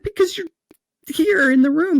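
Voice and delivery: laughing at the surprise visit; laughing explanation. Retold or imitated. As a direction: blurt it out laughing. Laughing exchange with God.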